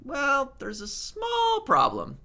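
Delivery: well, there's a SMALL problem, drawn out; emphatic small. Shown as a demonstration. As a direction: lean on small, like stating it pointedly. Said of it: "if you want to say".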